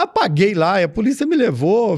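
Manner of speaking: usual speaking voice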